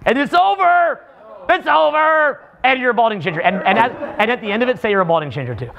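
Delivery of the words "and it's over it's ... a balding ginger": higher-pitched mock fury over the ruined video; demo rant. Shown as a demonstration. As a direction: rant about the lost video in a raised, whining pitch. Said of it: "like me in the video".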